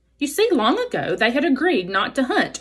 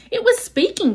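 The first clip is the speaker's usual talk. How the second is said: much higher owl hoot; 13 semitones higher than usual. Acted. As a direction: answer as the excited owl. Owl answers.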